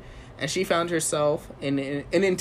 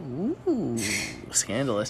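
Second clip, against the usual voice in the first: titillated; 4 semitones lower, 5 dB quieter, slower than usual. Pretend. Teasing reaction.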